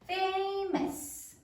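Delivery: "Famous" in a high, held teacher's call; high-pitched dictation of "Famous"; word read back. Shown as a demonstration. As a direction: say "Famous" high and evenly after sounding it out. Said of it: "say it as you write it".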